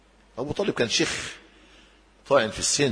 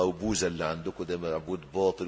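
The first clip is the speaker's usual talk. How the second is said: usual speaking voice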